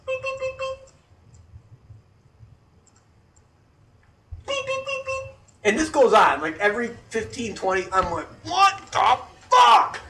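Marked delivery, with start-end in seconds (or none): 0.1-5.3 high, steady electronic-style beeps; imitated beeping. Noise heard at five thirty, high mimicked beeping. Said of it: "A M, I hear". 8.4-10.0 loud, high, each word dragged out; exasperated mock shout. Kept up every fifteen minutes, exasperated shout. Repeated beeping all night, retold.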